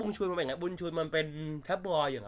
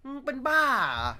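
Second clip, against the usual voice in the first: scornful jab; 9 semitones higher, 3 dB louder than usual. Real, not acted. Scolds angry fans.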